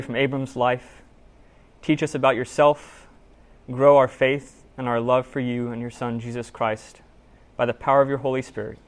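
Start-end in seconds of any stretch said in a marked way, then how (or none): none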